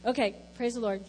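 ordinary talk, nothing marked

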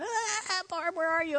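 imitated pained plea; acted labor distress. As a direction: call weakly for the midwife. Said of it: "And I'm going".

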